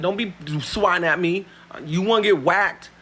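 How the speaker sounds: retold confrontation, loud and heated; angry warning. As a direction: warn him off with rising heat. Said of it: "I was like".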